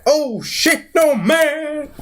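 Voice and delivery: high, drawn-out cry of dismay; mock fear. Loki seeing Hulk stare at him, hammed-up fear. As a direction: whine it high, stretching the last word. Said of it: "He's afraid".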